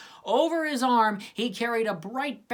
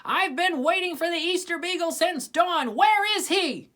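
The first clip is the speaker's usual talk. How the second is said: high, louder impatient scold; 11 semitones higher, 4 dB louder than usual. A narrator's reading. Sally scolding Linus about the missing beagle, high and loud. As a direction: demand an answer. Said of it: "She scolded Linus".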